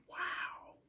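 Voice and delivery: a breathless whispered "Wow". Retold or imitated. Learning she cheats on her dude, whispered wow. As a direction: hush it in awe. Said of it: "I'm like".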